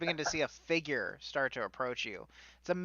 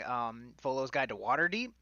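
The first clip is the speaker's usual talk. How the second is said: ordinary delivery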